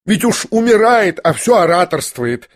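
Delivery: exclamation. A narrator's reading. Lizaveta exclaims at the coughing dying youth, indignant. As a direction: burst out in exasperation. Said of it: "воскликнула Елизавета Прокофьевна".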